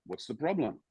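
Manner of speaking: soft, unbothered and a touch detached; a manager too calm to care. Retold or imitated. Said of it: "And he goes".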